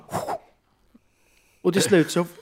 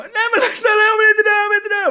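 high, fast, loud whimpering; terrified begging; 7 dB louder, faster than usual. Retold or imitated. Victim begging the axe man, hammed-up terrified wailing. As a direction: plead hysterically for your life.

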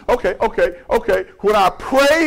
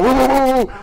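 high whooping "woo" noises; imitated wobbling car; 4 semitones higher than usual. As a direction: mimic a car shaking at speed. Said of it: "car was going".

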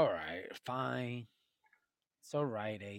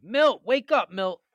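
calling out; 3 semitones higher, 6 dB louder, slower than usual. Real, not acted. Waking sleeping friend.

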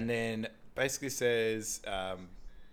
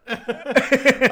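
much higher and louder, laughing through it; squeaky laughing hi; 14 dB louder than usual. Retold or imitated. Scared player greeting the tackler, laughing squeak. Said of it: "he goes".

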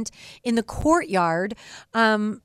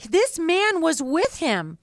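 mock accusation, higher and drawn out; insistent accusation; 7 semitones higher, slower than usual. Maidservant tells the courtyard about Peter, imitated insistent accusation. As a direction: point him out insistently in a raised pitch. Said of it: "says to the other people, she's like".

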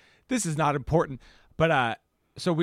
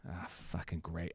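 much softer, lower, fed-up grumble; muttered annoyance; 11 semitones lower, 16 dB quieter than usual. Retold or imitated. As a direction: sigh it out under your breath, fed up. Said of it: "And I was like".

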